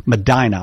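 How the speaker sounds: Medina said as muh-DYE-nuh; local pronunciation. Shown as a demonstration. Host repeats the town to remember it, Medina as muh-DYE-nuh. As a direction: practise Medina with DYE in the middle. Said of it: "All right, I'll remember that".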